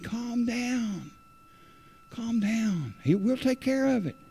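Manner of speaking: gently voiced pastor, hushing him; imitating the pastor. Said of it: "But he said".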